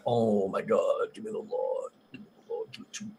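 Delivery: imitating awe in a fading whisper; whispered craving. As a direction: breathe it out like a desperate plea, fading away. Retells his eager reaction to trailer.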